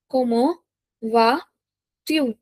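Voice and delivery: slow "Comment vas-tu" with long gaps; piece-by-piece teaching of "Comment vas-tu"; word by word. Shown as a demonstration. Teacher dictates phrase in pieces.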